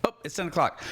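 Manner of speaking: ordinary talk, nothing marked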